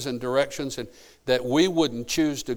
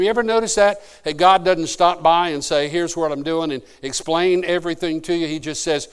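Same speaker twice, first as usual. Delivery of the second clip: louder, higher fervent build; rising preaching; 3 semitones higher, 6 dB louder than usual. Acted. Preacher urging the congregation to trust God, fervent. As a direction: lift the church as the sermon climaxes.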